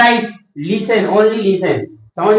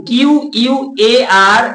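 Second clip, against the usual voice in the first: slow spelling; much slower than usual. Shown as a demonstration. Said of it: "spelling out quarter for students".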